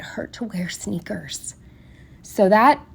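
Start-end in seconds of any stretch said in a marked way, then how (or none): 0.0-1.5 quick whispered aside; whispering. Real, not acted. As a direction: whisper it like a small complaint. Complaining aside about bruised foot.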